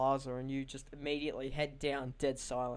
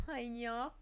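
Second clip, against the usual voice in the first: sing-song hiya; 7 semitones higher, 7 dB quieter than usual. Retold or imitated. Silly office greeting bit.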